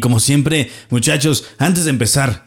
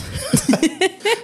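high laugh; 4 dB quieter than usual. Real, not acted.

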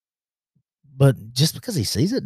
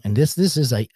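ordinary talk

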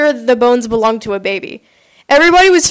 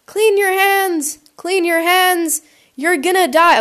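mock teacher shouting; 6 semitones higher, slower than usual. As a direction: imitate a teacher shrieking orders without real volume. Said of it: "they start shouting at you".

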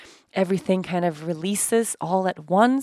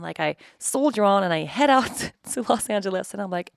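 usual speaking voice

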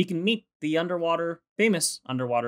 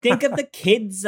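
pleading mock voice; 7 semitones higher, 4 dB louder, slower than usual. Echoing the sisters' plea.

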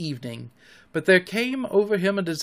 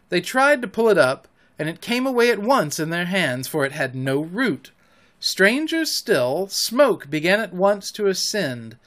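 plain reading voice

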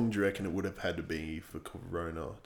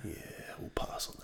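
whispered aside; 11 dB quieter than usual. Real, not acted. Dry aside declining the barrel.

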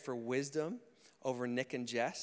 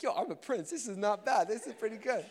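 put-on playful, high and louder baby's thoughts; imagined baby's voice; 8 semitones higher, 5 dB louder than usual. Pastor voicing the happy baby's thoughts, playful high voice. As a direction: speak up high as a pleased, cocky baby. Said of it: "it's like".

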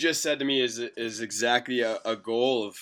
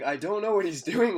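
mock French Canadian accent; 9 semitones higher than usual. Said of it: "That's my French Canadian accent".

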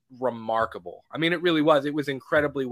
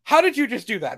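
higher, louder astonished question; amazed question; 9 semitones higher, 5 dB louder than usual. Retold or imitated. Andrew on the table asking Toby, high astonished question. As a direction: ask it wide-eyed in disbelief. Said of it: "He was like".

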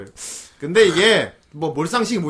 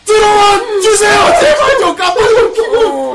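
very high, loud, drawn-out wail; exasperated pleading; 13 semitones higher, 9 dB louder than usual. Real, not acted. Host begging donor to warn first, shrieking plea. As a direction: plead at the top of your voice, half laughing. Host startled by sudden big donation.

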